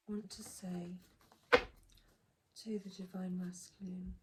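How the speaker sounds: normal talking voice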